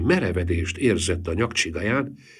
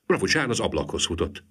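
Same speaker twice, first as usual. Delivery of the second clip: plain reading voice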